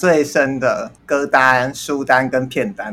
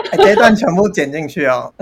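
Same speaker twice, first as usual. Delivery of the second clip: ordinary talk, nothing marked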